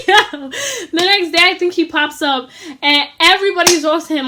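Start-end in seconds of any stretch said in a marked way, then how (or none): 0.1-1.4 slow words through laughter; laughing while talking. Real, not acted. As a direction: let the laugh spill into the words. Laughing mid-story.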